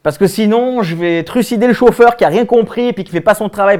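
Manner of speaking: high, loud tirade; angry threat. Acted. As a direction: threaten with mounting exasperation. Menace jouée dans le bus. (menace exaspérée)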